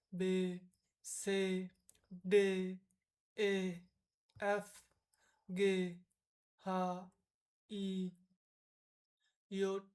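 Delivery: each letter name said slowly and separately; letter names with pauses between; spelling out the German alphabet. Shown as a demonstration. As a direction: recite the German letters one by one, teacher style. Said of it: "প্রথমে আমরা দেখব".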